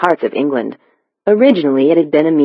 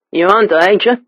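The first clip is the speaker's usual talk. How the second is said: thick Cockney, pitched higher; Cockney accent; 5 semitones higher than usual. A narrator's reading. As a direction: speak it as a rough London Cockney stranger. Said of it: "The stranger's Cockney accent was nearly impenetrable".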